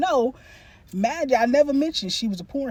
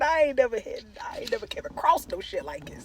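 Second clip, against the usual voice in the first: usual speaking voice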